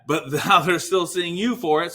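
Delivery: normal talking voice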